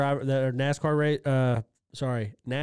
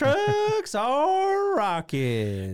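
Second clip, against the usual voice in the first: high, drawn-out announcer call; track announcer; 12 semitones higher, much slower than usual. Retold or imitated. Co-host imitates the racetrack commentator, high drawn-out announcer voice. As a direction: stretch it out like a hyped-up event announcer. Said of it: "the commentator or the, uh, the guy".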